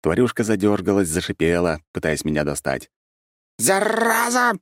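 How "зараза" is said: shrill squeal, much higher; shrieking creature. A narrator's reading. Creature squeals at the man holding it, high shriek. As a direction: squeal it like a cornered little beast. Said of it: "взвизгнула она".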